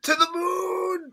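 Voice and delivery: high, slow cheering cry; mock hype shout. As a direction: cheer it like a crypto fan. Mocking crypto bros.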